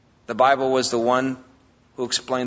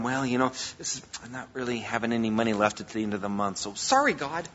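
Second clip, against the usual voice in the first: mock excuse; 5 semitones lower, 8 dB quieter, faster than usual. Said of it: "revolve around".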